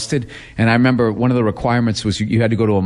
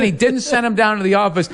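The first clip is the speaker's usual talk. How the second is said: ordinary delivery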